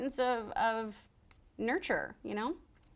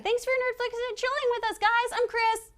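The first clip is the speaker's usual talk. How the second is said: high, upbeat goodbye; cheerful host sign-off; bright sign-off; 14 semitones higher, 4 dB louder than usual. Real, not acted. Show sign-off.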